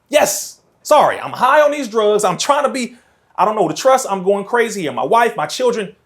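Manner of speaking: loud, high, agitated confession; worked-up outburst. Acted. Man called crazy answering his accusers, loud and agitated.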